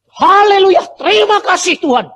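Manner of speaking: last shout of praise before dying; loud shout. Retold or imitated. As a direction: cry out with all your strength. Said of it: "Teriak keras".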